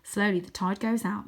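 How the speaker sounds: plain reading voice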